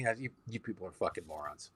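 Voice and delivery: muttered insult. Retold or imitated. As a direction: mutter it under your breath as you leave. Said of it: "I just walk away going".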